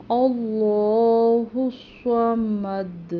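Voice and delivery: slow, drawn-out tajweed recitation; Quran recitation. Shown as a demonstration. Teacher reciting to students, slow chanted Quran recitation. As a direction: recite each word slowly and melodically.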